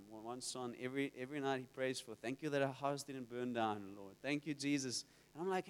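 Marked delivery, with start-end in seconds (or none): 2.1-5.0 low, soft recited thanks; murmured list of thanks; routine child's prayer. Retold or imitated. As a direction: imitate a kid's rote bedtime prayer. Imitating son's nightly repeated prayer.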